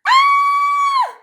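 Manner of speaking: piercing very high loud scream. A narrator's reading. Girl screams as the wolf pounces, a piercing high scream. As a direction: scream in terror.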